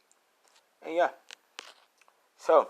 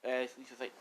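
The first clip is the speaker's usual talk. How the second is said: usual speaking voice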